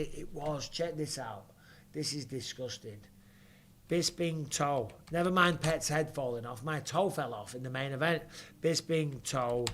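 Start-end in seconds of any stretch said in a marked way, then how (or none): 3.9-4.9 drawn out slowly like a headline; slow mock title. Real, not acted. Naming the gross injury for the viewers, slow mock title. Coins a joke name. 8.6-9.6 drawn out slowly like a headline; slow mock title. Real, not acted. Naming the gross injury for the viewers, slow mock title. Repeats joke name.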